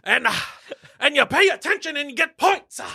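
high-pitched Southern Baptist sermon voice; Southern Baptist preacher. Pretend. Promising points to the faithful, mock Southern preacher. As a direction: punctuate it like a hammed-up preacher. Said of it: "Southern Baptist Brando".

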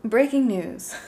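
lightly put-on news anchor voice. Podcast host trying an anchor voice on request, light mock newscaster. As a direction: attempt a news anchor's announcement. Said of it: "why don't you try an anchor voice".

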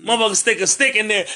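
ordinary talk, nothing marked

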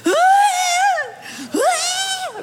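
high, hammed-up wail "Ah! Ah!"; a long mock-anguished wail, way up high; wailing cry. Wife in the kitchen while guest listens, wailing. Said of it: "I'm in the kitchen going".

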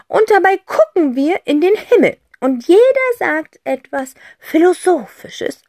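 playful aunt. Acted. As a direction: pitch the game with gusto. Aunt explains the game. (Tante Rotz erklärt verspielt das Spiel)

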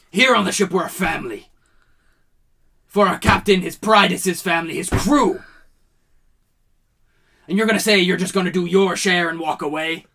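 a proud captain shaming a lazy crewman; angry lecture. Acted. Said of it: "No one liked seeing the captain mad".

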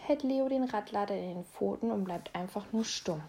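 usual speaking voice

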